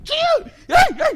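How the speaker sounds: very high, very loud yelps; fervent prayer shout. Shown as a demonstration. Preacher imitating old-time fervent prayer, shrieked. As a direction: demonstrate frantic crying out to the Lord. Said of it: "이렇게".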